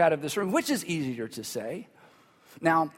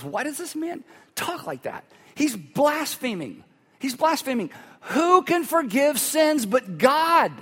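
higher pitched, slow appalled accusation; scandalized thought; 7 semitones higher, slower than usual. Retold or imitated. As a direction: mimic the shocked scribes muttering blasphemy. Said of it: "They're thinking to themselves".

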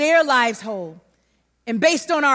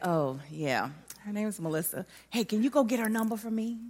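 much lower and softer, crushed; deflated; 5 semitones lower, 11 dB quieter than usual. Retold or imitated. Girl covering her hurt for the boy, deflated. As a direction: say it with your heart sinking. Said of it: "I was like".

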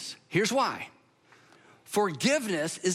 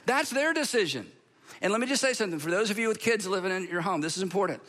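ordinary delivery

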